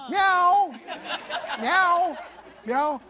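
high mewing cat imitation; playful high cat meows. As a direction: mew like a stray at the door. Cat returns next day.

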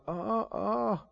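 soft, low, hesitant stalling; uncomfortable mumbling. Acted. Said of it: "It just seems uncomfortable to them".

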